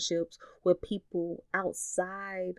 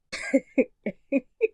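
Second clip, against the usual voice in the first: laugh; 8 semitones higher, 5 dB louder than usual. Real, not acted. Laughing at her own impression.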